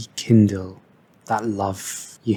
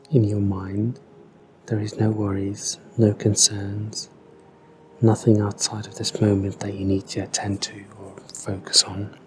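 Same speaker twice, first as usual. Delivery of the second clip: guided meditation; 3 dB quieter than usual. Real, not acted. Guiding listeners to breathe and picture Jannah, calm guided meditation. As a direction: talk them gently through each breath, unhurried.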